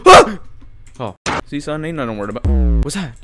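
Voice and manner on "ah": short, high, loud scream; startled scream. Real, not acted. Gamer hit by a jump scare, startled scream. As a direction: yelp at a sudden scare.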